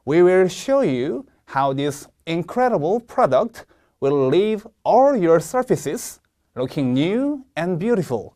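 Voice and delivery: slow model reading with pauses; slow read-along. Shown as a demonstration. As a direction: read slowly so learners can follow. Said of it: "저와 함께 같이 읽어볼게요". (따라 읽기용 느린 시범 낭독)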